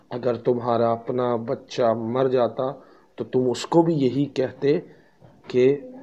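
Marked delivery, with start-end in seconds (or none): none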